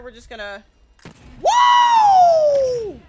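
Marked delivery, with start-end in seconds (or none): 1.4-3.0 big high whooping yell; a real thrilled shout; excited whoop. Real, not acted. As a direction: let out a wild cheer. Excited cheer mid-fight.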